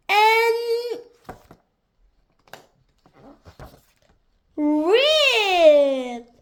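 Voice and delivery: loud, high, stretched-out rip; dramatic ripping. A narrator's reading. Narrator acting out something ripping in two, dramatic and drawn out. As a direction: draw the word out like a tear. Narrator acts out tearing map.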